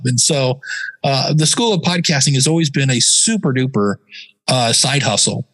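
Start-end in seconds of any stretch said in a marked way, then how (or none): none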